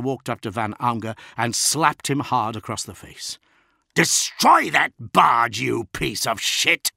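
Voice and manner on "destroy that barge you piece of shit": louder, slow furious command; furious order. A narrator's reading. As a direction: spit it with rage. Lord slaps officer, orders.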